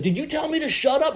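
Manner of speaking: high-pitched, affronted challenge with rising end; mock indignation. Conductor challenging the passenger, mock indignation. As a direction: imitate her outraged question.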